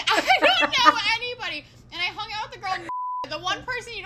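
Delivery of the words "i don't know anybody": much higher, laughing wail; laughing mock panic. Left alone at the party, telling her friend, laughing wail. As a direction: laugh out your helpless panic.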